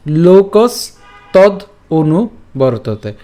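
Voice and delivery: "লোকস তদ অনু" in separate slow pieces with pauses; broken up. Shown as a demonstration. Teacher shows the split reading, broken up slowly. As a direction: stop after "লোকস" and after "তদ". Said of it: "এই ভাঙা অংশটুকু আপনারা এইভাবেই পাঠ".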